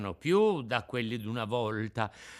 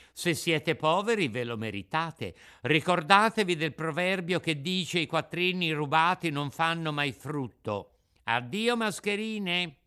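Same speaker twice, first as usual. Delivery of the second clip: put-on raised, lively puppet-boy voice; scornful Pinocchio; 5 semitones higher, 4 dB louder than usual. Puppet refuses the begging swindlers, smug and scornful. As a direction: lecture them, then dismiss them.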